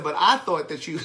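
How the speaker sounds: laughing. Real, not acted. Laughing while retelling his embarrassment.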